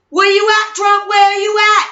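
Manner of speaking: loud, high mock call to Trump. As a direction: shout out across the room for him. Calling out for Trump.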